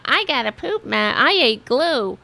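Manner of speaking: lightly voiced clueless cartoon kid; a hint of a dim Simpsons kid; dopey cartoon kid voice. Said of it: "one of the cartoon characters from The Simpsons".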